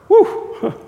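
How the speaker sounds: high, loud woo; exhilarated cheer; excited whoop. Real, not acted. Preacher whoops after the story.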